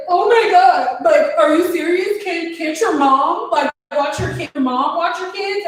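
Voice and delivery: high, put-on client voice; mock upset client; imitated incredulous pleading.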